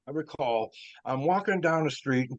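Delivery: usual speaking voice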